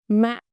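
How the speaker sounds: "Mạ" dropping quickly and stopped; short heavy tone. Shown as a demonstration. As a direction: drop "Mạ" and cut it short. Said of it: "short and heavy".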